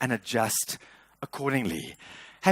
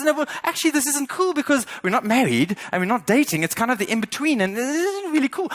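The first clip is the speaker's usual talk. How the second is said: much higher, louder mock wife; pitched-up imitation of a demanding wife; wife's voice; 11 semitones higher, 4 dB louder than usual. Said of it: "And she says".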